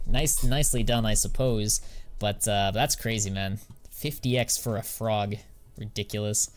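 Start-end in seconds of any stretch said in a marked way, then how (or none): none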